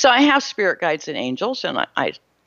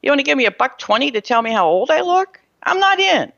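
high, incredulous "Really?" and an offended comeback; sarcastic, affronted disbelief; indignant retort; 8 semitones higher than usual. Retold or imitated. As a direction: sound personally insulted by the offer. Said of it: "what I, my feeling about it was".

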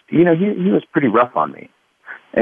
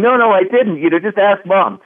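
put-on child pleading; 8 semitones higher than usual. Child pleading innocence to dad.

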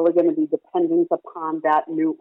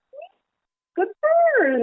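high-pitched squeak, then a bright "good bird"; squeaky high bird call; much slower than usual. Retold or imitated. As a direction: mimic the parrot's squeaky word. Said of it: "repeat that in the same tone".

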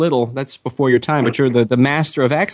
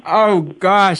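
usual speaking voice

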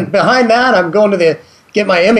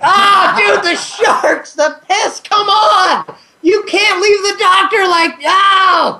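much higher, pleading protest; excited protest; 10 semitones higher, slower than usual. Real, not acted. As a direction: beg him to finish the story. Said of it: "Don't stop there, dude".

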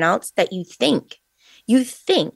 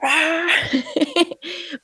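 a high burst of laughter; a high giggle after the quote; 9 semitones higher than usual. Real, not acted. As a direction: laugh high at your own joke.

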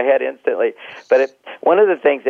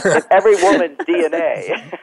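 talking through a laugh; amused laughing remark; 5 semitones higher than usual. Real, not acted. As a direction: laugh as you say it. Laughing while telling.

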